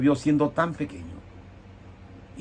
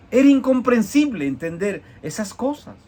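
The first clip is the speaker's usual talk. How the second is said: incredulous outburst; 10 semitones higher, 5 dB louder than usual. Real, not acted. Preacher's outburst of disbelief.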